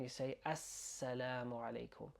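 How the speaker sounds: ordinary talk, nothing marked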